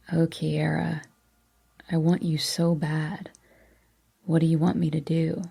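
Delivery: low with longing; a touch of heated desire. A narrator's reading. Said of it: "a heat in his voice".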